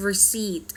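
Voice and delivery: "receipt" said with no P sound; silent P. Shown as a demonstration. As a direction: say "receipt" as re-SEET, P left silent. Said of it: "ตัว P เราไม่ออกเสียงนะ". (ออกเสียง "receipt" แบบไม่ออกเสียงตัว P)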